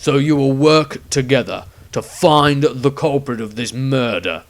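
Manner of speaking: loud, threatening command; stern ultimatum. A narrator's reading. Witch hunter addressing the whole group, loud threat. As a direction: make the threat plain.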